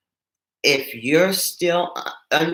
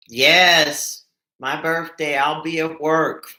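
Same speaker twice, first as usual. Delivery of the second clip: usual speaking voice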